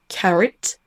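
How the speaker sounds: "carrot" as a native says it; English "carrot", schwa in the second syllable; English pronunciation. Shown as a demonstration. Teacher quotes a native speaker, English schwa. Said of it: "un anglophone prononcer le mot".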